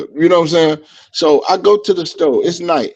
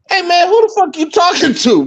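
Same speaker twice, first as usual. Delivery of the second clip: retold indignant challenge; 12 semitones higher than usual. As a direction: challenge a stranger, replaying the quarrel. Retold confrontation with a stranger.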